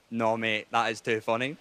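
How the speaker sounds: put-on low drunken inner voice; drunken brain. His drunken brain talking him into it, low voice. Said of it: "your drunken brain goes".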